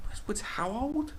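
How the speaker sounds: high, slow disbelieving rise; stunned incredulity; incredulous question. Retold or imitated. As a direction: ask it in total disbelief. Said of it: "It's like".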